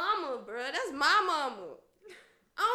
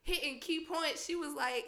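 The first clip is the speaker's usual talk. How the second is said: ordinary talk, nothing marked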